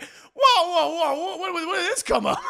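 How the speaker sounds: high, half-laughing alarm; startled laughing whoa. Retold or imitated. Bystander reacting to a sudden blowup, stunned. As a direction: back off, stunned and amused. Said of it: "I was like".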